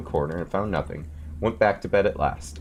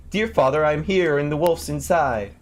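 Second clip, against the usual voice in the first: joyful cry; 8 semitones higher than usual. A narrator's reading. Tom Thumb hearing his father, high joyful cry. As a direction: call out gladly.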